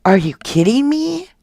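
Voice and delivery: normal talking voice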